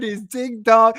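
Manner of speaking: normal talking voice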